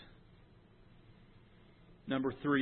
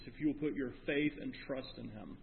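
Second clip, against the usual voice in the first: ordinary delivery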